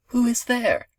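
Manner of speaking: high-pitched grandmother calling out. A narrator's reading. Sick grandmother calls to whoever knocked, high calling voice. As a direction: call out high as the grandmother in bed.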